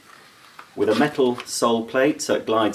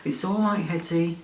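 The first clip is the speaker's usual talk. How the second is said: high, soft cooing reassurance; mock soothing; 7 semitones higher, 4 dB quieter than usual. Comforting a vacuum that can't see, high soft cooing. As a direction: comfort it like a little pet.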